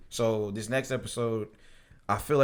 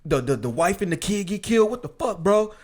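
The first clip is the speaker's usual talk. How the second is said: high, loud, furious yelling; angry outburst; 8 semitones higher, 4 dB louder than usual. Retold or imitated. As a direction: rage at him in disbelief. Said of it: "He busted in there like".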